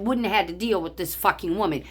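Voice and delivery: ordinary delivery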